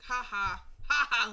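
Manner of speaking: loud, mocking fake laugh; sarcastic fake laugh. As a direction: laugh sarcastically at a bad joke, loudly. Mocks family's supposed joke.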